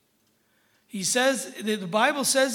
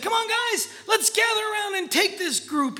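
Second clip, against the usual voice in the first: high, excited call to the guys; rally the group with a high shout; mock rallying shout; 12 semitones higher than usual. Leader calling his guys to gather round, mock rallying shout.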